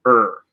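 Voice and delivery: drawn-out "er" that is almost all R; slow rhotic American "er"; American R sound. Shown as a demonstration. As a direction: hold "er" as an R with hardly any vowel. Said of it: "you almost don't really pronounce a, a vowel".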